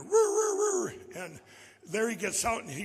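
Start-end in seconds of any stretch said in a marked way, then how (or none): 0.0-1.0 loud, high mimicked siren wail; imitated siren. Storyteller mimicking what sounded before the parade, loud siren whoops. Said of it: "and I hear".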